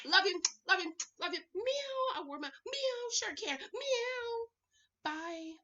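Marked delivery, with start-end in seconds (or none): 1.6-2.2 high playful cat meow; cute high meow. Pretend. Cat sound about her shirt. 3.7-4.5 high playful cat meow; cute high meow. Pretend. Cat sound about her shirt.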